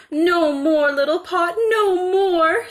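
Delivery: high, loud desperate shouting; frantic shout. A narrator's reading. Mother shouting at the pot as porridge floods the house. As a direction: yell at the pot in panic. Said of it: "she shouted".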